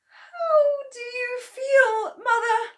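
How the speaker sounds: high, slow, tearful anxious question. Acted. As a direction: ask her tiny mother in a high, worried, near-crying voice. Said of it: "asked Missus Cranky anxiously".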